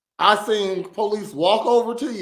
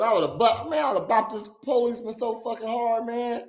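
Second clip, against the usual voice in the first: ordinary talk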